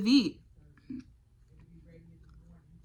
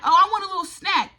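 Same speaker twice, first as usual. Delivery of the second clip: mock self voice; 9 semitones higher, 6 dB louder, faster than usual. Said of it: "I'm like".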